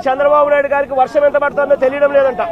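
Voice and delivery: loud sarcastic jab; loud mocking. Real, not acted. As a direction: ridicule him at full volume. Politician mocking opponent. (ప్రత్యర్థిని గట్టిగా వెక్కిరిస్తూ)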